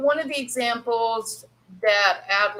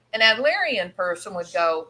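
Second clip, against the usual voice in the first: usual speaking voice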